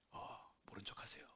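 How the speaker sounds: whispered, urgent plea to stay unnoticed; a scared whisper begging to be ignored; hushed plea. Pretend. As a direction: whisper it so nobody overhears. Peter wants to stay unknown.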